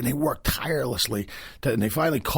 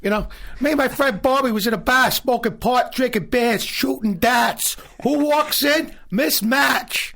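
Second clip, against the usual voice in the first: put-on high, loud Boston accent telling a bar story; Boston Southie accent; 13 semitones higher, 4 dB louder than usual. Boston Southie guy telling his bar story to the host. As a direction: keep the Southie guy going, loud and pitched up. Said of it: "That's a good Southie accent".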